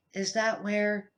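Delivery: plain reading voice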